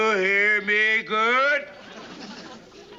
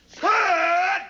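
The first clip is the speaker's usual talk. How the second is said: shouted high command; barked halt command; 9 semitones higher, 4 dB louder than usual. Acted. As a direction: snap the platoon to a stop.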